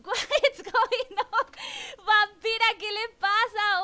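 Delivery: high, loud, laughing through the words; laughing disbelief. Real, not acted. Streamer laughs in disbelief at the big donation. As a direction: laugh out the question, can't believe it.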